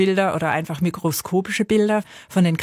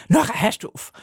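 mahnende Eltern; 4 semitones higher than usual. Retold or imitated. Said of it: "sie haben dann natürlich immer gesagt".

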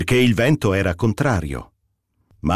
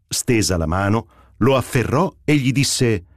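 ordinary talk

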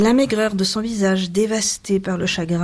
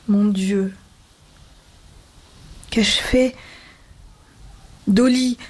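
halting plea, rising in pitch; choked pleading; 4 semitones higher than usual. A narrator's reading. Husband begging his wife, choked and breaking off. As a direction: plead haltingly, close to a sob. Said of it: "Un sanglot le prit à la gorge".

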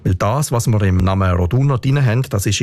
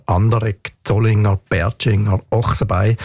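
"Anderegg, Zollinger, Bertschinger, Ochsenbein", each stressed on its first syllable. Shown as a demonstration. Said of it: "immer auf der ersten Silbe".

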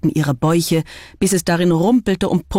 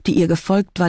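ordinary delivery

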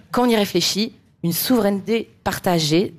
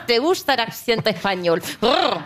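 hammed-up Spanish with trilled R; playful Castilian Spanish accent; 2 semitones higher than usual. Shown as a demonstration. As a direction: show off your Spanish accent, rolling the R. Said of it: "quand je fais l'accent espagnol".

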